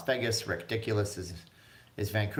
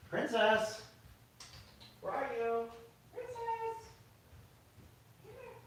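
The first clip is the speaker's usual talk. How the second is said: high, soft sing-song call to the cat; 7 dB quieter than usual. Real, not acted. Owner softly calling for the cat. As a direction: coax the cat out, high and gentle.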